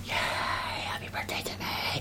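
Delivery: sly whispered aside; whispering. Real, not acted. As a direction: whisper it to yourself. Mock self-congratulation aside.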